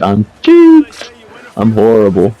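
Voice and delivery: sudden high, loud yelp; benched quarterback, hammed-up loud panic; mock panic. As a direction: squeal it out in fake despair.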